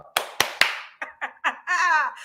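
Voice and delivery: real run of amused chuckles; hearty laugh. Real, not acted. Coach tickled by her mouse-to-lion line, hearty laugh. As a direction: laugh freely at your own line.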